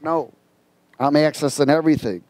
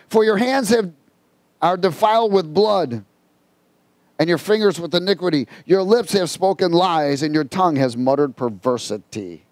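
emphatic preacher reading a verse; forceful pulpit recitation, settling at the end; scripture reading; 3 semitones higher than usual. Real, not acted. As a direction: declaim it firmly, phrase by phrase. Reads scripture aloud while preaching.

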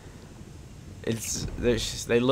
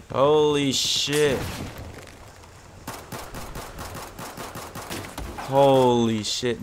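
stunned awe; much slower than usual. Real, not acted. Awed reaction to in-game event.

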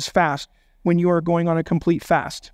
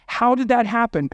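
usual speaking voice

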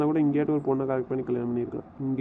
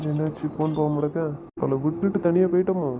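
ordinary talk

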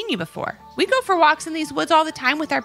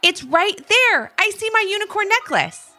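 thrilled to have spotted it; excited; 13 semitones higher, 3 dB louder than usual. A narrator's reading. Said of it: "exclaimed Ivy".

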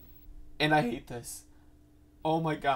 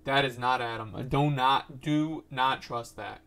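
normal talking voice

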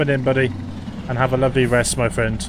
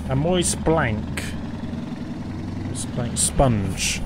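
drawn-out x0.5, each word tasted; slow savouring; 4 dB quieter, much slower than usual. Real, not acted. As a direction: roll the words slowly round your mouth. Savouring a weird chat word.

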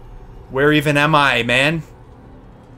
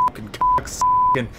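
shrieking curse. Real, not acted. Rant swearing.

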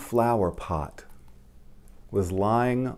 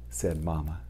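ordinary delivery

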